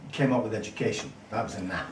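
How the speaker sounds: usual speaking voice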